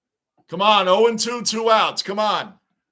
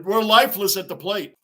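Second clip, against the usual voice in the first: ordinary talk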